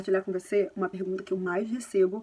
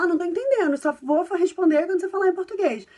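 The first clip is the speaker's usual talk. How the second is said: strict parent refusing; 7 semitones higher, 6 dB louder than usual. Retold or imitated. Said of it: "ao invés de, de falar".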